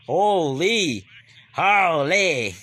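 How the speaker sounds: loud stunned exclamation; amazed. Real, not acted. Fan stunned by Maxey's play, loud and amazed. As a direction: gasp it out in awe.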